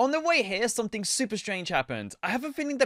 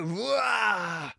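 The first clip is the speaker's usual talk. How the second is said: mock zombie roar on "Rah". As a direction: growl "Rah" like a hammed-up zombie. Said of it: "they don't go".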